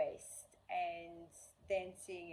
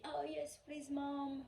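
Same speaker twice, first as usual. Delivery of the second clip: put-on higher, childlike eager plea; a hint of a delighted child; imitating eager daughter; 8 semitones higher, slower than usual. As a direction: lightly voice a happy little girl. Daughter voiced, eager request.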